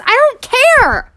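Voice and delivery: high, loud, slow drawn-out scorn; exaggerated disdain. Real, not acted. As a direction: stretch it out, dripping with contempt. Ranting at classmates.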